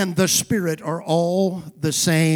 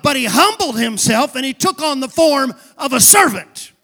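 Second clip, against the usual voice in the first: emphatic sermon building to loud proclamation; rousing preaching; 4 semitones higher, 6 dB louder than usual. Real, not acted. Preacher contrasting scripture with legends, rousing preaching. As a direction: build toward a ringing scripture quote.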